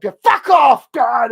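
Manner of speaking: loud, high furious yell at a stranger; angry shout. Retold or imitated. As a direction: bark it at someone who bumped you. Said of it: "it was just like".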